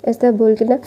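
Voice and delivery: plain reading voice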